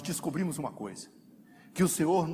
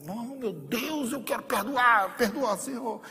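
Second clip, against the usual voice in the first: higher, slow, pleading with a laugh; whiny prayer; 7 semitones higher, slower than usual. Retold or imitated. Believer forcing himself to pray for forgiveness, whiny and slow. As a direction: plead like a sulky child begging God. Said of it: "Aí você vai orar".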